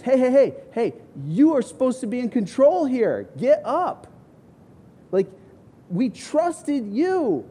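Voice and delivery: high, loud, panicked disciples crying out; frantic panic. Retold or imitated. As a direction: yell in terror as the boat sinks. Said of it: "they're frantic".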